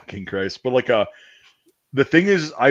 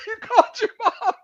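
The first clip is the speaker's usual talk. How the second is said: laughing tease; 3 dB louder than usual. Real, not acted. Laughing while teasing friend.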